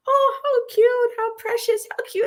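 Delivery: very high-pitched imitated gushing; mock excited cooing. Imitating the band seeing farm animals, high excited cooing. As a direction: coo over them way up high. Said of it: "so excited because".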